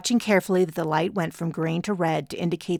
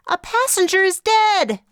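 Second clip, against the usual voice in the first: much higher, louder, alarmed shout; panicked cry; 14 semitones higher, 7 dB louder than usual. Acted. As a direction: burst in crying out in panic. Chloe bursts in panicked.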